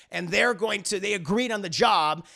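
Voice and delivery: usual speaking voice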